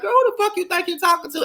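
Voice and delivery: very high, fast, put-on sassy voice; shrill sassy clapback. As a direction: screech the comeback as a mock Shay. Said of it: "if I was Shay".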